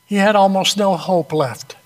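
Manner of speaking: ordinary talk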